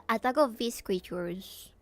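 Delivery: ordinary talk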